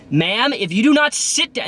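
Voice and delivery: high, firm yell over the intercom; retold yell. Bus driver yelling at a drunk passenger. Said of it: "and I yelled".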